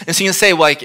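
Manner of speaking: ordinary talk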